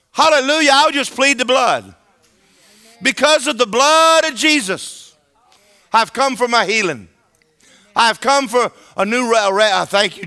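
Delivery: high, loud, full-voiced declaration; bold shouted prayer. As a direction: proclaim it boldly like storming the throne room. Acts out bold prayer of faith.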